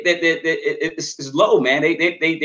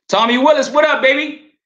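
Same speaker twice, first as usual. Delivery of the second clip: shout-out; 9 semitones higher, 4 dB louder than usual. Real, not acted. Shout-out to a friend.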